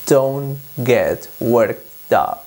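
very slow, careful English example; slow model reading. Shown as a demonstration. Teacher to Spanish learners, very slow model phrase. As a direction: say each word slowly for students. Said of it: "para decirle a alguien".

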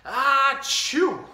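huge loud sneeze; big sneeze. A narrator's reading. Reading the bear's giant sneeze aloud, loud. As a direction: let the sneeze explode. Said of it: "gave an enormous sneeze".

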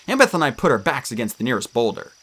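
plain reading voice

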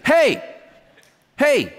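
high, punchy wake-up "hey"; rousing call. Acted. Angel kicking sleeping Peter, sharp rousing call.

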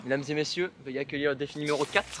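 announcer. Acted. Announces challenge four.

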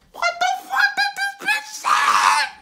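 high, drawn-out shriek of disbelief; outraged classroom shout. Retold or imitated. Whole class reacting to the teacher's remark, outraged shriek. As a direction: screech it slowly, very high and loud.

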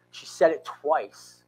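normal talking voice

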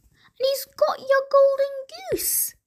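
very high-pitched shrieked accusation; screaming wife. A narrator's reading. Wife warning the giant of the thief, screaming. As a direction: shriek the alarm right through to the last word. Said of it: "screamed his wife".

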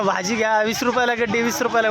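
loud, high hawker's cry; vendor's call. Shown as a demonstration. Father showing his son how to hawk vegetables. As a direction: shout the price to passers-by. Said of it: "अरे ओरड ना".

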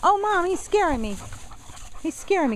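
high-pitched scared pup voice; voicing the dog. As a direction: squeak it out as the frightened dog.

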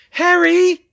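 very high, loud cry of a name; high-pitched frightened shout; panicked call. A narrator's reading. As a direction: shout for him in alarm. Calling after hearing a body slump.

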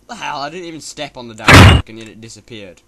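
loud, fast angry complaint; exasperated rant. Real, not acted. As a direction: rant at the screen.

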